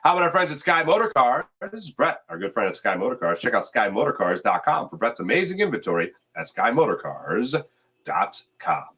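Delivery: usual speaking voice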